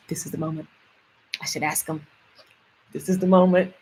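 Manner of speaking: soft, low thought to oneself; inner voice. Acted. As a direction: think it aloud quietly, with pauses. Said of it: "in your brain, you be like".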